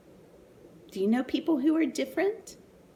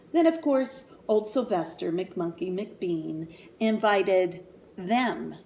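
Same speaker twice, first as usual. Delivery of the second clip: usual speaking voice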